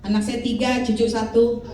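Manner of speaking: ordinary talk, nothing marked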